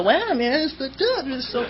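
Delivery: imitating a wasted friend, lightly voiced, breaking into laughter; mock drunk stoner. Friend gawking at the bent axle, mock drunk and laughing. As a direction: play the stoned buddy gawking at the wreck, half laughing.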